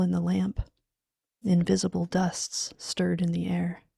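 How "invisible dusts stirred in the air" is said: ordinary delivery, nothing marked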